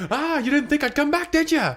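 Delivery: high, loud gloating taunt. Acted. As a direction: gloat at the cornered witch. Jake taunting witch.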